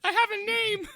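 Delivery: very high squeaky crab voice. Acted. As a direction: squeak like a tiny cartoon crab. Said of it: "This would be my crab voice".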